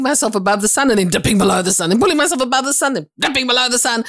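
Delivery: fast, high-pitched run-on; frantic repetition. Retold or imitated. Believer recounting a tiring day of struggle, frantically. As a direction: rattle it off like an exhausting cycle. Mimicking exhausting up and down.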